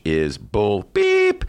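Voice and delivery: "bull beep" slow and punched loud; censored bull beep. Shown as a demonstration. Quoting the column's swear, slow and loud. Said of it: "excuse my language".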